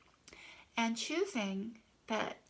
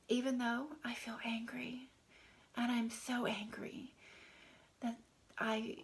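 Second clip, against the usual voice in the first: hushed, intimate affirmation read; tapping affirmation; 2 semitones higher than usual. Shown as a demonstration. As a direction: murmur it gently to yourself while tapping. Tapping script demo.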